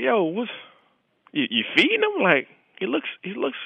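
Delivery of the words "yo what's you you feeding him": high, incredulous question; startled friend asking what went wrong; shocked question. Retold or imitated. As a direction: ask in disbelief, pitched way up.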